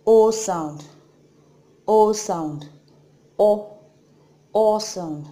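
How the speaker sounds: tightly rounded "O" said loud; Korean vowel O, rounded. Shown as a demonstration. Teacher drilling the rounded "O". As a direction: model the closed "O" with round lips. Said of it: "This is".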